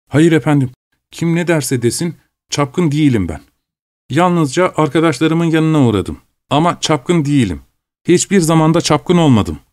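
a hint of a grumbling manservant; Petruşka the servant. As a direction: protest like a sulky servant. Petruşka denies being a rascal.

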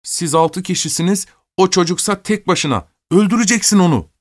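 high, pleading reproach building in force; alarmed, high-pitched scolding; urgent protest. A narrator's reading. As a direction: plead to stop an attack. Alyosha protests at the stoning.

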